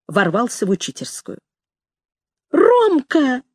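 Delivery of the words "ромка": high, delighted call of a name; joyful cry. A narrator's reading. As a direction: cry out high, beside yourself with joy. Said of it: "закричала я вне себя от радости".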